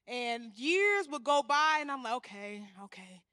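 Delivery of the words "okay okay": much softer, resigned okay okay; quiet reluctant giving in. Retold or imitated. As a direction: sigh it out in defeat.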